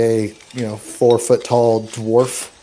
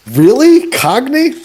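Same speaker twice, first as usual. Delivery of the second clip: surprised disbelief; 13 semitones higher, 7 dB louder, slower than usual. Real, not acted. Told dwarves are Cockney.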